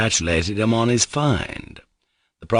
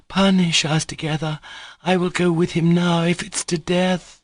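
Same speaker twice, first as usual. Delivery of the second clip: frantic cry; 9 semitones higher than usual. A narrator's reading. Grushenka to the officials, pleading frantically. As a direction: beg to share his fate. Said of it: "Grushenka cried frantically".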